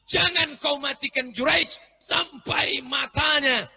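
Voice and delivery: high slow shouted curse; angry curse. Acted. Mother's angry curse prayer. (ibu mengutuk anaknya sambil berteriak pelan)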